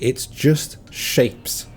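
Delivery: slow, scornful drawn-out just shapes; mocking quote. Quoting his mom scornfully. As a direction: mimic her contempt, stretching it out slowly. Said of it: "and she said".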